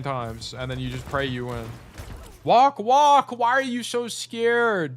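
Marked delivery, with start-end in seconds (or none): 2.4-3.3 high, loud urging to walk; loud impatient urging; urgent shout. Real, not acted. Coach urging player in replay. 3.3-5.0 exasperated drawl. Real, not acted. Coach urging player in replay.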